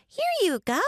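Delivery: put-on high-pitched, gushy little-girl offering; gushing child ghost. A narrator's reading. Child ghost offering picnic food to guests, gushing. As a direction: gush it out high and eager. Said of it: "Gretchen gushed".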